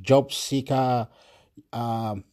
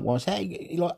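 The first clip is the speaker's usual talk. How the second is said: ordinary delivery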